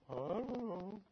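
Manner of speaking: small, soft mumbled answer; feigned innocence. Retold or imitated. Camp boys answering the youth director, softly feigned innocence. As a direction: play the culprit dodging the question.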